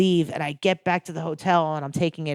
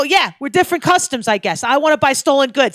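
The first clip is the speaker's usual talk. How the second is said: higher, louder sarcastic retort; 5 semitones higher, 5 dB louder than usual. Retold or imitated. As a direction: throw it back mockingly. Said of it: "I go".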